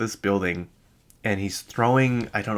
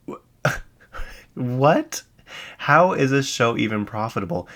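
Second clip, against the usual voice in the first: baffled, rising what, then higher-pitched question; can't believe it, half laughing; incredulous; 4 semitones higher than usual. Retold or imitated. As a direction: replay a disbelieving reaction. Acting out own baffled reaction.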